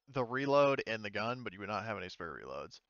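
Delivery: usual speaking voice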